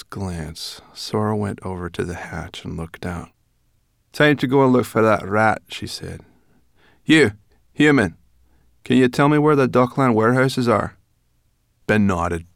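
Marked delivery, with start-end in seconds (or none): 4.1-5.6 higher, loud, brisk; brownie girl. Acted. Brownie girl deciding to leave, loud and brisk. 7.0-11.0 loud, higher, bossy question; brownie girl. Acted. Brownie girl bossily asking the boy for directions.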